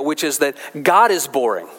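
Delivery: ordinary talk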